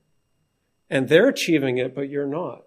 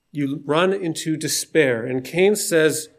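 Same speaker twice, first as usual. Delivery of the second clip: normal talking voice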